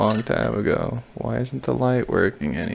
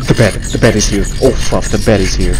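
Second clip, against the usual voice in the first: loud alarm; 3 semitones lower, 8 dB louder, faster than usual. Real, not acted. Alarm as the bed appears.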